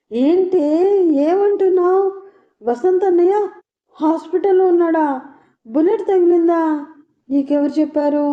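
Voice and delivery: high, loud rush of alarmed questions; panicked questions. A narrator's reading. A mother hears her brother was shot, panicked and loud. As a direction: fire off each question in a panic. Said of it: "కంగారుగా వరుసగా questions".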